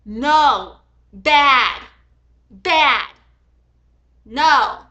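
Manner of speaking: loud, barked no's and bads; real angry outburst. Real, not acted. Furious at Rayleigh for threatening police, loud yelling. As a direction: explode at the seller like scolding a dog. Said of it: "I got mad".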